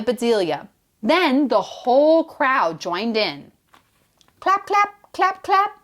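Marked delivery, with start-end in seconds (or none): 4.4-5.7 higher, chanted clap clap; rhythmic chanted claps; clapping sound. A narrator's reading. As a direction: say it like applause. Crowd claps for her.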